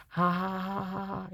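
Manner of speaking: hollow mock ha-ha; dry sarcastic ha ha ha; sarcastic fake laugh. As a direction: laugh it off bitterly, flat. Bitter laugh after giving in.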